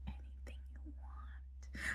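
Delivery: slow, very soft whisper; whispered imitation. Anne offers the grant money, slow whispered imitation. Anne's retold answer about grant.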